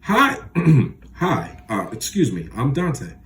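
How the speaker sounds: nervous cracking high voice, throat cleared; a flustered young man whose voice breaks meeting the girl. A narrator's reading. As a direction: stumble through a nervous hello, voice breaking. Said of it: "my voice cracked a little".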